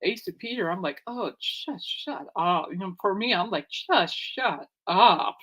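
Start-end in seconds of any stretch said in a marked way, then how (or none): 1.1-2.7 slow, drawn-out dismissive sigh of a line; fed up. Retold or imitated. Fan telling arguing fans to stop, slow and weary. 3.7-5.4 slow, drawn-out dismissive sigh of a line; fed up. Retold or imitated. Fan telling arguing fans to stop, slow and weary.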